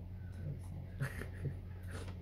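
quiet airy chuckle at himself; a breathy laugh breaking the act; soft amused exhale of a laugh. Real, not acted. Laughs at own fake sadness.

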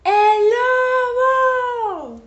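high, loud alarm cry; sudden full-voiced yell; shout. A narrator's reading. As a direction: holler it long and high.

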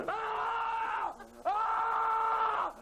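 put-on drunken yelling. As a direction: holler like a drunk at dinner. Drunk guy yelling at dinner.

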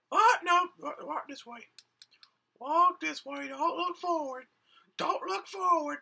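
high-pitched, loud urgent orders; high character voice. Acted. Character telling the host not to look, high and urgent. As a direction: bark it out in the squeaky hero voice.

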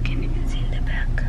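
breathy hushed whisper; soft secret whisper. Real, not acted. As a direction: whisper it so nobody wakes. Hushed question in the car.

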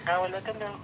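ordinary talk, nothing marked

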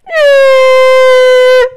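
very loud, very high sustained shriek; long piercing squeal. Real, not acted. Gamer reacting to the action, piercing squeal. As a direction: let out a drawn-out squeal at full volume. Scream during gameplay.

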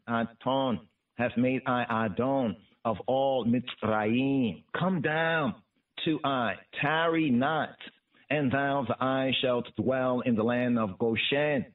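ordinary delivery

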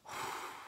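shaky emotional sigh. Real, not acted. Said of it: "I am not gonna get emotional".

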